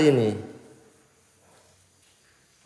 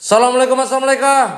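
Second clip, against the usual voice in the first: calling out; 8 semitones higher than usual. Real, not acted. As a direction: call the greeting down the empty hall. Greeting spirits in empty hospital.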